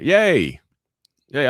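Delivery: high, loud "yay"; delighted cheer. Real, not acted. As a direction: cheer for their good news. Cheers a viewer's good news.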